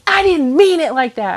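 high, loud, defensive retort; snippy boyfriend imitation. Girlfriend imitates boyfriend during their argument, snippy and defensive. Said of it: "he got a little snippy with me".

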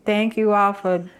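podcast sign-off. Acted. Show sign-off.

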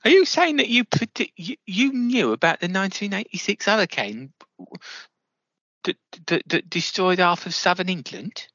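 high, astonished questioning; an interviewer stunned by a boastful claim; amazed. Acted. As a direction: climb high in disbelief and stay there.

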